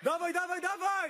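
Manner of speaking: high, repeated urging; excited gym hype; shouted encouragement. Real, not acted. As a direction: push him on with rapid-fire cheers. Cheering on a lifter mid-effort.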